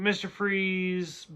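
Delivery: normal talking voice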